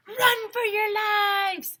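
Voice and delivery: high, slow, loud scream of alarm; panicked shout. Acted. Neil warns classmates of the forest fire, panicked shout. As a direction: cry it out in panic. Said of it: "shouted Neil".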